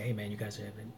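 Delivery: ordinary talk, nothing marked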